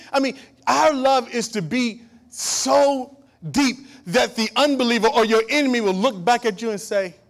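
loud, high, driving sermon voice; full-force preaching. Acted. Preacher rousing the congregation about God's power, loud preaching. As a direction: preach it hard to the back pews.

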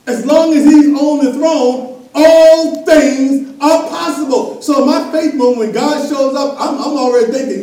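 loud, high preaching push; full-force preaching. Acted. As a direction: drive it home to the church.

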